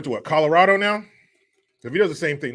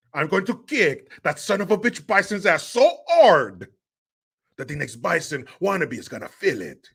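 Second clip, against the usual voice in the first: hammed-up French-accented action hero; mock French accent, punchy and over-emphatic; 3 semitones higher than usual. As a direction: play a tough-guy threat in a thick French accent. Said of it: "you gotta ditch that French accent".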